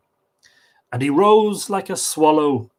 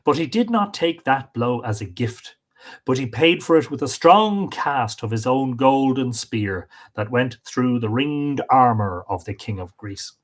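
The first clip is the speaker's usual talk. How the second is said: plain reading voice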